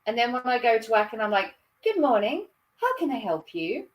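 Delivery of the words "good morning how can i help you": higher, swooping posh greeting; posh phone voice. Acted. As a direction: turn suddenly posh to answer the work phone. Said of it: "you suddenly turn posh".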